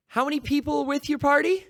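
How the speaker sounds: high, bright service voice; chirpy theme park greeter; cheerful cast member. Pretend. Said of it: "what game days in Anaheim sounds like".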